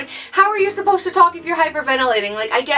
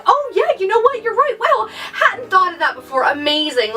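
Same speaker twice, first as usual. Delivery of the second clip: much higher, gushing fake realization; sarcastic agreement; 6 semitones higher than usual. Mocking her own fake gratitude to a comforter. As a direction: play the instantly cured patient, dripping sarcasm. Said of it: "expect me to be like".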